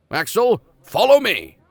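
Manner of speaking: high, loud bark of a hot-tempered professor; impatient shout. Acted. Uncle calling his nephew to follow, shouting. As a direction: yell it across the house. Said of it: "the professor was again shouting".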